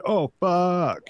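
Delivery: mock dread, falling away; lightly voiced skydiver. Guest imitates somebody skydiving without his parachute, mock panicked cry. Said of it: "somebody skydiving said".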